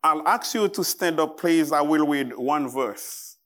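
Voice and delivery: ordinary talk, nothing marked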